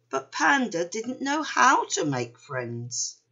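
ordinary delivery, nothing marked

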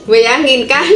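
ordinary talk, nothing marked

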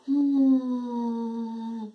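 long, drawn-out thoughtful "mm"; pensive hum. Acted. Whole town pondering the rumour, pensive hum. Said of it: "se quedó muy pensativo".

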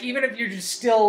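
ordinary talk, nothing marked

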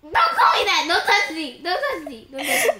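mock outrage. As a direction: push them off in pretend anger. Play quarrel after being called Sprug.

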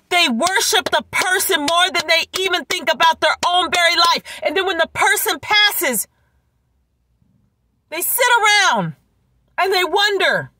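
normal talking voice

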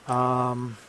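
plain reading voice

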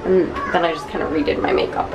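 usual speaking voice

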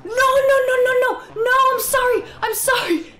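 loud, frantic no's and sorry's; panicked shouting. Real, not acted. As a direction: yell in alarm at the screen. Panicking as the puppy dies.

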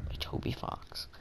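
soft whisper of the credit. Real, not acted. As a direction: breathe the name like a secret.

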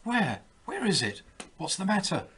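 high-pitched startled questions; alarmed. A narrator's reading. Old man startled awake by his wife's cry, alarmed. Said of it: "He started up in alarm".

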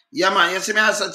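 usual speaking voice

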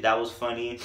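ordinary talk

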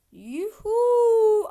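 high, loud cheer; whoop. Real, not acted. Player whoops loudly while jumping. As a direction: let out a joyful whoop.

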